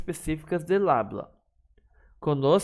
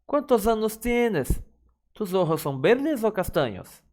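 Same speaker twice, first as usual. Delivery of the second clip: much higher, clear question intonation; questioning tune; 7 semitones higher than usual. Shown as a demonstration. Teacher modelling question intonation for students. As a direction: ask it with an obvious rising question.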